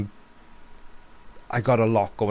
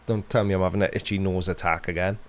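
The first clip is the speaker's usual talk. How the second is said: ordinary talk, nothing marked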